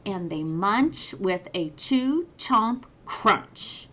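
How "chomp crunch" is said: ordinary delivery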